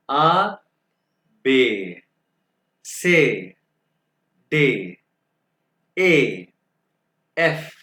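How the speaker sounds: each letter called out singly, with long pauses; German alphabet demonstration. Shown as a demonstration. Teacher reads alphabet for students to follow, emphatic spaced-out letters. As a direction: model each letter name firmly, drawn out, one at a time. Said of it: "दोबारा pronounce करके बताऊंगा".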